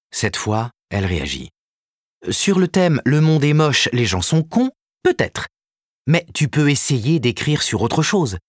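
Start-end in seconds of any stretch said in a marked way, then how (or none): 2.3-8.4 higher and louder, sarcastic agent; mock his favourite theme; lightly voiced Karen, sardonic.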